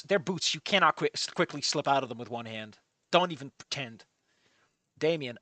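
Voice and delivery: ordinary delivery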